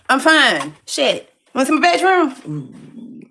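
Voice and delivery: loud, high, irritated protest; defiant insistence. Real, not acted. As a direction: snap back that all is fine. Defiant answer to the doubters.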